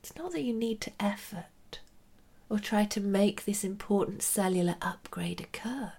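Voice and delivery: soft whispered meditation guidance; right in your ear. Acted.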